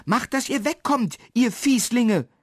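high-pitched indignant shout; scolding. A narrator's reading. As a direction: chase off troublemakers. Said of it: "Sie haben Marli ja nur schimpfen gehört".

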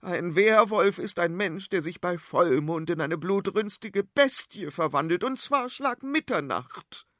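high, know-it-all queen lecturing; high-pitched royal scolding; bossy queen voice. Acted. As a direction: talk down to them like an impatient queen. Queen Serafina lectures the children.

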